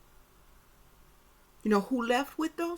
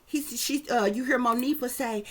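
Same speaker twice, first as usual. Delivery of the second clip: usual speaking voice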